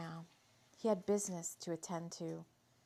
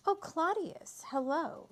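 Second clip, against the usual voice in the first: much higher, pleased surprise; surprised recognition; 8 semitones higher, 3 dB louder, slower than usual. Acted. Tamara realizing Claudius is calling her, surprised. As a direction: voice leaps up as she realizes who it is.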